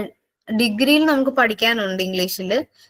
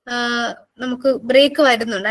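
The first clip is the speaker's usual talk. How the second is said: ordinary delivery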